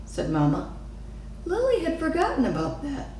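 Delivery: ordinary delivery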